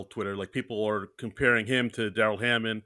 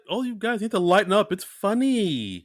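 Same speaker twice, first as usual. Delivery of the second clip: mock cheerleader; 6 semitones higher than usual. Retelling a cheerleader telling critics to lighten up, mock protest.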